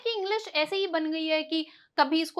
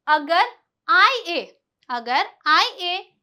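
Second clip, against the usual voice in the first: slow spelled letters; 4 dB louder, much slower than usual. Shown as a demonstration. Teacher slowly spelling the rule letters, drawn out. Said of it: "spelling the rule letters slowly".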